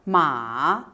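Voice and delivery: "ma" dipping low, then rising; third tone. Shown as a demonstration. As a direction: sink deep on "ma", then lift it back up. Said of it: "The third tone".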